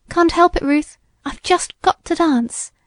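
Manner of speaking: high, lilting excuse while dancing; carried away by the dance; giddy. A narrator's reading. Giddy sister can't stop dancing.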